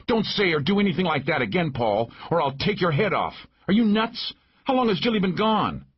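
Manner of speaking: high-pitched furious woman, not full volume; angry scolding. A narrator's reading. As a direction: tear into him in a high voice. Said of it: "we heard Maggie scream at the top of her lungs".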